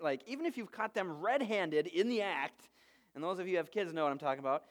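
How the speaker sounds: normal talking voice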